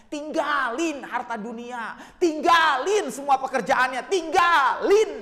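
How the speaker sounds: loud, high exhortation, slow on key word; impassioned preaching. Real, not acted. Preacher urging children to leave work for parent, rising shout. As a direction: build to a stretched shout.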